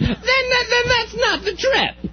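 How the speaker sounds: very high, squealed objection; exasperated shriek. Real, not acted. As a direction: protest in a piercing falsetto squeal.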